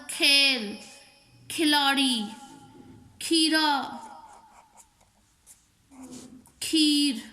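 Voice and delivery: खेल, खिलाड़ी, खीरा, खीर with a breath-puffed kh; aspirated kh. Shown as a demonstration. Teacher giving Urdu examples of the khe sound, aspirated kh. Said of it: "Urdu का लफ्ज़ खे से निकालना है".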